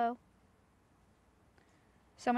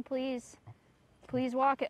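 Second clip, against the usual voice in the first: normal talking voice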